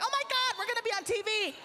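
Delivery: very high-pitched excited squeal; mock girly excitement, way up high; freaking out. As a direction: squeal like thrilled girls. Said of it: "they're freaking out".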